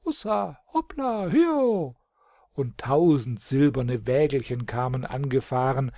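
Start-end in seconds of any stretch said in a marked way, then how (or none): 0.0-2.0 high chirpy little dwarfs calling; tiny dwarfs cheering, high and bright; chirping dwarf voices. Acted. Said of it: "klangen zirpende Stimmen".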